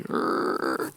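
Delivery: low, rumbling mock growl. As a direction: growl at how hard it is. Imitates reaction to a hard passage.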